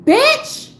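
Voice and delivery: high, loud outraged outburst; going off. Retold or imitated. Customer exploding at a rude worker, retold going-off. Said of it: "I went off on that lady".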